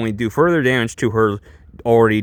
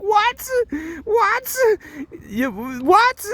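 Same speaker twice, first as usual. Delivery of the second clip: stunned disbelief; much slower than usual. Retold or imitated. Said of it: "I'm like".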